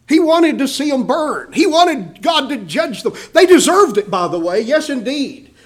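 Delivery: high, loud rising emphasis; preacher climbing to a shout; fervent preaching. Real, not acted. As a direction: build to a peak of righteous heat. Preacher voicing Jonah's wish.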